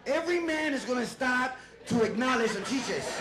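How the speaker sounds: ordinary talk, nothing marked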